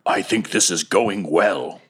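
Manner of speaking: slow, lumbering golem; drawn-out golem voice. Acted. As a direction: speak slowly as a big stone creature. Golem confides with thumbs up.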